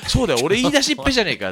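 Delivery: high, fast startled outburst; sudden realization. Retold or imitated. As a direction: blurt it out, caught off guard. Retelling his own sudden realization. (はっと気づいた早口の声)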